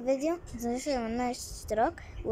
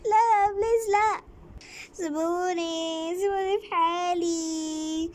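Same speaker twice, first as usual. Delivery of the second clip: high, loud, then slow begging; desperate pleading; 7 semitones higher, 3 dB louder, much slower than usual. Acted. Captive girl pleading with her kidnappers, frightened and crying. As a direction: beg them to let you go.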